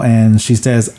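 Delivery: normal talking voice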